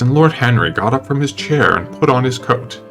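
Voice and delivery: ordinary delivery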